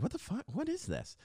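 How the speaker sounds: squeaky what-on-earth incredulity; baffled disbelief. Retold or imitated. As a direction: act out being utterly confused by a summons. Teller baffled by a summons.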